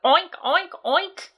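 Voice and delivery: oinking pig. A narrator's reading. Boy imitating pig.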